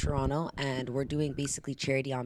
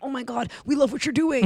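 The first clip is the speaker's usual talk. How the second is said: gushing fan; 9 semitones higher, 5 dB louder than usual. Retold or imitated. People now praising her.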